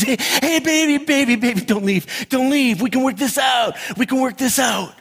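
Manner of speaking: high-pitched, urgent begging, hammed up; mock desperate pleading. Boyfriend begging over the intercom, mock desperation. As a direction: plead like a dumped movie boyfriend.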